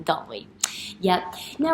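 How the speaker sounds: ordinary talk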